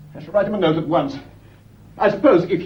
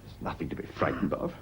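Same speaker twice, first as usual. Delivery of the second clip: hushed reassurance; 9 semitones lower, 11 dB quieter than usual. Acted. Husband hushes frightened woman.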